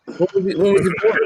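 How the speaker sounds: high-pitched mock puzzlement, stumbling into the question; puzzled questioner. Stranger asking where grandpa was born, high mock puzzlement.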